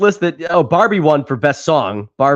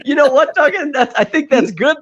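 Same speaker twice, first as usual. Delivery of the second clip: laughing; 10 semitones higher than usual. Real, not acted. Laughing while reassuring friend.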